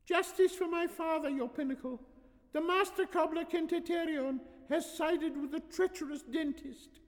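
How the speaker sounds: put-on very high little-girl voice; pleading young daughter. Daughter pleading for her father, high childish voice. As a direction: recite the plea in a child's voice.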